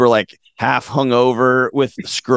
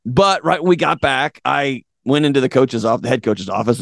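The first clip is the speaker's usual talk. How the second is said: ordinary talk, nothing marked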